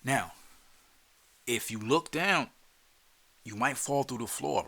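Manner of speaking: ordinary talk, nothing marked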